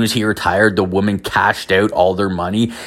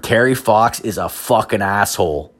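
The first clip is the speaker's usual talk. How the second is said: usual speaking voice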